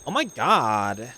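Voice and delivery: put-on slow, drawn-out valley girl gush; selfie voice. Shown as a demonstration. Passenger who should have the selfie voice, slow drawn-out gush. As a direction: stretch it like filming a selfie. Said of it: "should have the selfie voice".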